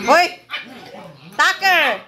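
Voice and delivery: high, loud call across the yard. Real, not acted. Owner calling her missing dog, high loud shout. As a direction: shout the name to fetch him.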